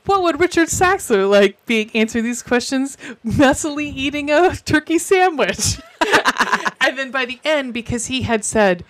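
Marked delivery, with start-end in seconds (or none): 0.1-5.8 mock interviewer voice. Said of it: "they're like".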